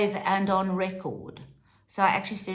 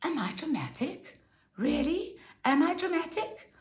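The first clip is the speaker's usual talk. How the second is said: mock innocence; 8 semitones higher, 3 dB quieter than usual. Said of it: "Sane turns around and goes".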